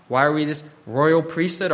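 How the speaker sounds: ordinary talk, nothing marked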